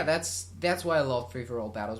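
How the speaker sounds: ordinary talk, nothing marked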